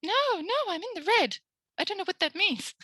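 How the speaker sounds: high, panicky squeal; hammed-up panicked learner; mock panic. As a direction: squeak it like a flustered student in the red. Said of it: "any of you have now gone".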